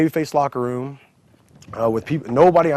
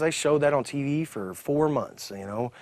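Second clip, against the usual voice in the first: ordinary talk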